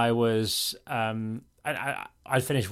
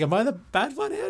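high self-doubt; 12 semitones higher than usual. Retold or imitated. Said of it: "I was sort of thinking".

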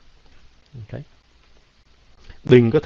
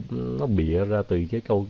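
ordinary talk

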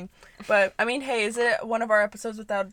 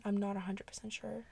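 whispering; 11 dB quieter than usual. Real, not acted. Unsure aside about the Quran.

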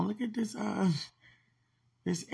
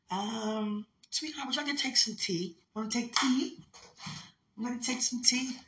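higher, sing-song offer to a pet; offering tea to sweetheart, much higher; 7 semitones higher than usual. Real, not acted. Offering tea to pet, baby talk.

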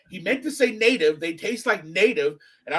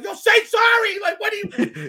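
high, loud panicked shouting; a friend screaming at his buddy to apologize; freaking-out yell; 13 semitones higher, 4 dB louder than usual. Retold or imitated. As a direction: yell it like he's losing it. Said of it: "his boy is freaking out".

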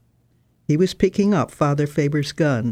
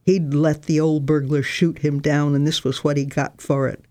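plain reading voice

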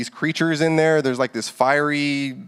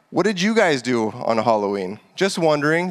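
usual speaking voice

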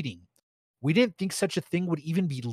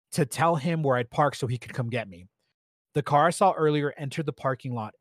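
ordinary delivery

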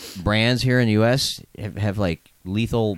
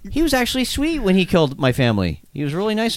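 sarcastic; 8 semitones higher, faster than usual. Acted. Sarcastic victim retelling.

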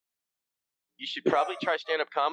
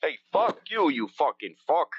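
New York asshole. Retold or imitated. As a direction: bark it like a rude New Yorker. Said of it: "you gotta be a total asshole when you do the New York thing".

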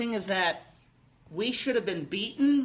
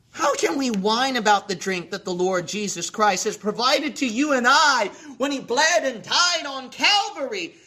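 high, driving pulpit exhortation; impassioned preaching; 4 semitones higher than usual. Real, not acted. Preacher asking how we can whine about Calvary, impassioned. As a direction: press each clause with raised, urgent pitch.

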